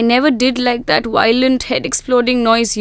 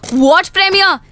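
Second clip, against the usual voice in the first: high, indignant challenge; scornful anger; 4 semitones higher than usual. Acted. Girlfriend disputing his friend's premiere, scornful. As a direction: dismiss the whole event with exasperation. Acted quarrel over cancelled dinner.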